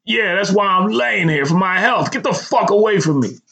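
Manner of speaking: high-pitched, sarcastic yelling; strained yell. A narrator's reading. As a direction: yell them away as best you can. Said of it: "yelled as best I could".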